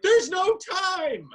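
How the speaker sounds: frantic mom. Retold or imitated. Mom hurrying son out.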